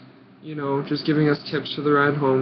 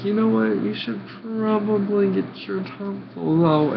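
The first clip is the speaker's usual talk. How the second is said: higher, lightly voiced doctor giving advice; 6 semitones higher than usual. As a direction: mimic the doctor's matter-of-fact advice. Doctor quoted advising surgery.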